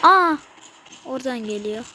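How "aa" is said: short loud high gasp of fright; sharp surprised cry; startled yelp. Real, not acted. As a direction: jump at the scare. Sudden scare in game.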